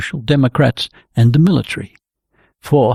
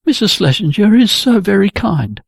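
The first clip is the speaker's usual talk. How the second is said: a higher, light deflecting tone; evasive; 8 semitones higher than usual. A narrator's reading. Jenny dodging Gunnar's concern, evasive and light. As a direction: brush the question off brightly. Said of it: "said Jenny evasively".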